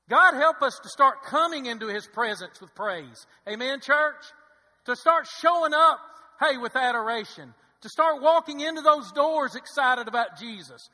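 loud, rousing pulpit cry; rally the church with rising fervor; full-force preaching. Acted. Preacher praying the church shows up with praise, loud fervent preaching.

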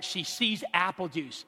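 normal talking voice

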